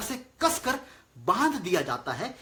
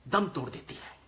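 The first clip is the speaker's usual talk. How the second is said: usual speaking voice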